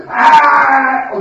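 loud hammed-up cry of grief; mock wail. Brothers crying out together to their father, mock wail. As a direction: howl together in fake sorrow. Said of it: "They all in one sound".

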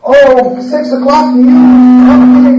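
loud, slow announcer voice; mock evening news anchor. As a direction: boom it out like a TV news intro.

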